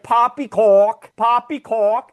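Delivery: hammed-up English accent, stretched slow; bad English accent. Street performer playing a British bobby, horrible English accent. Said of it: "this really bad English accent".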